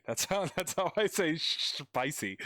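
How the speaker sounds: laughs mid-line, then "sh-, spicy" with pitch jumping up; giggly "sh-, spicy" as if owning the joke; laughing word swap. Shown as a demonstration.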